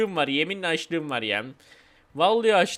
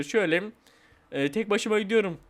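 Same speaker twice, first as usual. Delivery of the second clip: normal talking voice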